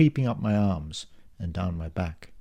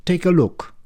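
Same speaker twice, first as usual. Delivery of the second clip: insistent; 8 semitones higher, 6 dB louder than usual. Acted. Clerk jabs at screen.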